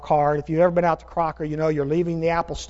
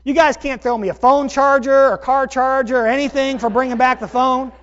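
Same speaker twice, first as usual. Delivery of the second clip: mock entitlement; 6 semitones higher, 4 dB louder than usual. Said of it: "'cause I'm going".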